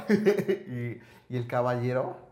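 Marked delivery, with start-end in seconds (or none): none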